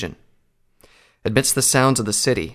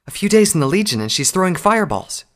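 high-pitched, amazed mare voice; impressed mare recruit; 9 semitones higher, 3 dB louder than usual. Acted. Slimblade marvels at a new legionnaire, high impressed voice. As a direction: marvel in a much higher character voice.

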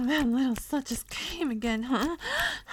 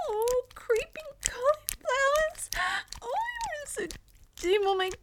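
much higher, startled voice; flustered, innocent character; 11 semitones higher than usual. Acted. Second character startled by partner, flustered high voice. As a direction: play the innocent one caught off guard.

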